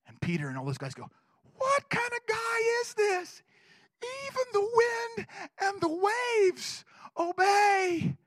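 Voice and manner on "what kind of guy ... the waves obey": higher, slow, hushed awe; awed wonder. Retold or imitated. Disciples after Jesus calms the storm, slow hushed awe. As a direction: marvel at the wind obeying.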